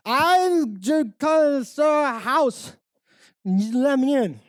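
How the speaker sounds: put-on high, slow drunken whine. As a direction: slur and drag it out, pitched high. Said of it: "four sheets to the wind".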